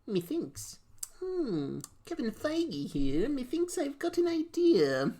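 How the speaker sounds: pompous, rising into high voice; Kevin Feige impression. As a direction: play a grand pondering producer. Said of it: "That's how he talks".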